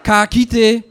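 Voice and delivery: put-on high, loud calling out to the boy; an old auntie hollering at an answer phone; high loud auntie voice shouting for her nephew. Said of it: "it goes like this".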